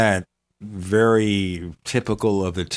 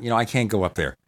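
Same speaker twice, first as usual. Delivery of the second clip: ordinary delivery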